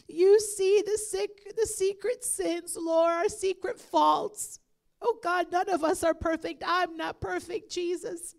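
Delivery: loud, impassioned pleading prayer; fervent prayer. Acted. Pastor leading the church in loud fervent prayer. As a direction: pray out loud with full force, calling on God.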